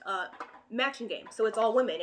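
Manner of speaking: ordinary delivery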